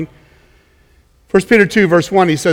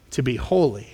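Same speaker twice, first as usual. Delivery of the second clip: normal talking voice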